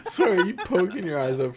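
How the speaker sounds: cracking up mid-sentence; laughing. Real, not acted. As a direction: laugh hard while talking. Bursts out laughing.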